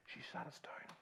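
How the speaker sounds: hushed whisper; whispered retelling. Retold or imitated. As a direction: drop to a whisper as if not to wake anyone.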